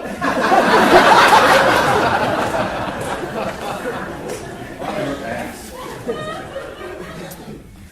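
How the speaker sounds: long loud fit of laughter. Real, not acted. Teacher cracking up at his own joke, long loud laughing. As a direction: laugh hard and keep going.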